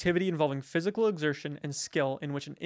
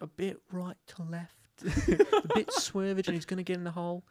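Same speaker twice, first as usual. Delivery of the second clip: golf commentator. Retold or imitated. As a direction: murmur it like a hushed golf announcer. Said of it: "the golf one where he's like".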